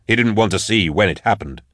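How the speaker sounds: plain reading voice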